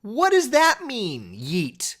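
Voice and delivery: high, slow, bewildered ask; baffled question. Retold or imitated. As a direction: drag it out in disbelief. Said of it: "And I'm like".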